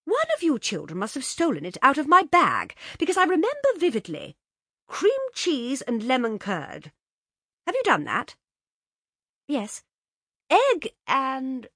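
fussing mother. A narrator's reading. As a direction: squint at the envelope and fuss. Mother fussing over envelope.

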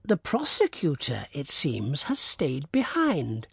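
a hint of a gossiping lady, slow at the end; light lady's voice, drawn out; lightly voiced society lady. A narrator's reading. A lady noting the prosecutor stayed, lightly voiced.

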